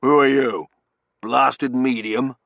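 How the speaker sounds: loud, slow, blustering challenge; suspicious colonel. A narrator's reading. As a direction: challenge the stranger loudly.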